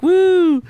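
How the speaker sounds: loud, high-pitched woo; high whoop. Retold or imitated. Imitating Joe Hendry on the chair, high whoop. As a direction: let out a high, sustained woo.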